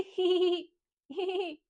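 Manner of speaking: high, playful horse whinny; imitación de caballo. Shown as a demonstration. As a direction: neigh in two high hee sounds. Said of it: "El caballo hace". (relincho agudo y juguetón)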